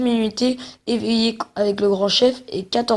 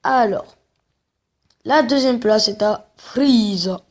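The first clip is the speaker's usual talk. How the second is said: put-on Japanese accent. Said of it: "j'arrête avec mon accent japonais".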